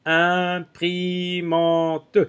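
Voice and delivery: "Imprimante" sounded out syllable by syllable; syllables stretched out. Shown as a demonstration. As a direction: spell out "Imprimante" by sound for the children, very slowly. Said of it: "où est-ce que j'entends le son un".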